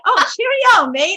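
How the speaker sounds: a silly British accent to warm up; hammed-up British accent. Shown as a demonstration. Narrator showing how she slips into a British accent. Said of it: "say a specific phrase to get into the accent".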